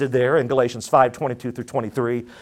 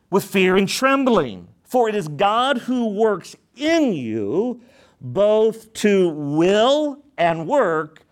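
raised, measured preaching of the verse; scripture reading; 4 semitones higher, much slower than usual. Acted. Pastor reading scripture to the church, raised and measured. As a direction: read the Word out to the congregation.

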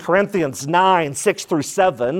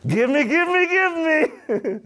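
high, whiny greedy chant of give me; 11 semitones higher, slower than usual. Acted. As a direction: whine like a greedy child who wants everything. Greedy world demanding more.

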